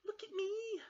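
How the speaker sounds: very high, loud cub call; excited Baby Bear. Acted. Baby Bear shows off at the stream, high excited call. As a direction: call out to show off.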